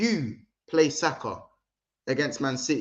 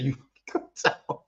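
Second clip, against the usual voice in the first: breathy unvoiced whisper of an order; hushed Arteta voice; 4 dB quieter than usual. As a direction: whisper the command like a secret plot. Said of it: "background conversation".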